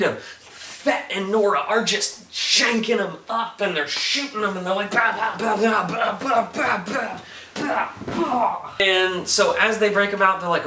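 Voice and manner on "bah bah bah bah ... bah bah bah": rapid higher bah gunshot noises; mock gunfire. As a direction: Fett and Nora shooting, fast mock gunfire.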